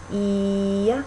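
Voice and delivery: "E" stretched long on an even pitch; slow, sustained "E" turning from glide to vowel; glide held out. Shown as a demonstration. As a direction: hold "E" out as one long steady ee. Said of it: "when you hold them out".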